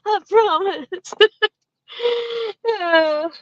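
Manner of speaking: breathless high giggle through the words; giggling. Real, not acted. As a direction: laugh it off, half crying with laughter. Embarrassed at a seat noise.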